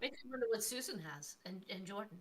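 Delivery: speaking off-mic and faint; far from mic. Real, not acted. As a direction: voice distant from the microphone. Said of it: "it sounds like you're far away".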